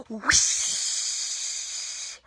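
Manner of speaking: long airy whoosh sound; breathy whoosh. Shown as a demonstration. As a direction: blow it out like wind. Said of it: "Can you do that with me?".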